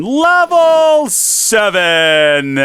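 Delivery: high, loud, very slow wail; despairing wail. Real, not acted. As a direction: stretch "Level seven!" out in despair. Lost levels, drawn-out wail.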